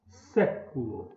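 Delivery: SÉ drawn out and emphasized in século; stressed first syllable. Shown as a demonstration. Teacher showing pupils the tonic syllable, stressed SÉ in século. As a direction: lean long on SÉ in "século", letting the rest fall. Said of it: "É o sé".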